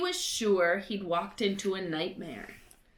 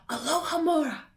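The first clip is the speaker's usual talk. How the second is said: ordinary delivery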